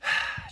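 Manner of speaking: loud weary sigh. A narrator's reading. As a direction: let out a sigh. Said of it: "Jack sighed".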